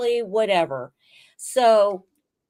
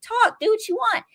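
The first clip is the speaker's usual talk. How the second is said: exasperated rant; 11 semitones higher than usual. Real, not acted. Ranting at the character on screen.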